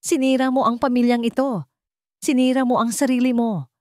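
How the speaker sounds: hateful. A narrator's reading. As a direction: spit it out at him with loathing. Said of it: "puno ng pagkamuhi".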